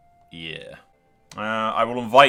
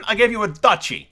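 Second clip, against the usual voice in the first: much higher and louder, pleading hard; exasperated plea; 10 semitones higher, 7 dB louder than usual. Pretend. Player to Tywin in the game, loud mock exasperation. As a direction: insist on "gave" with mock outrage.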